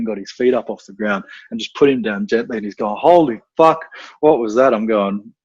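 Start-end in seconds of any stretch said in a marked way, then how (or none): 3.0-4.7 mock shock.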